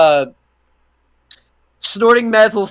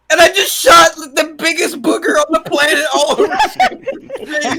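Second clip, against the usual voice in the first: high, laughing through the words; can barely get it out laughing; 8 semitones higher than usual. Real, not acted. Laughing at booger mishap.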